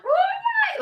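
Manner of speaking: very high stretched what that falls away; excited shriek of disbelief; giddy disbelief. Retold or imitated. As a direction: gasp it out in thrilled shock. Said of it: "and I'm like".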